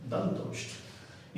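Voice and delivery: दंतोष्ठ whispered very softly and very slow; whispered model word. Shown as a demonstration. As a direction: breathe out दंतोष्ठ without voice so the lips and teeth show. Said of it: "दंतोष्ठ हो जाएगा".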